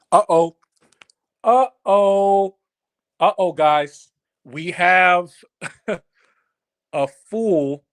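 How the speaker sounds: loud teasing uh-oh, sing-song; mock alarm. Host to stream chat as a troll arrives, loud mock alarm. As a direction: sound the alarm for the troll, playfully.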